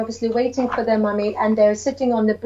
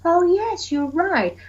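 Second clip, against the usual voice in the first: put-on higher, warm yes to the child; warm agreement; 7 semitones higher than usual. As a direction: praise the child gently. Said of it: "And I said".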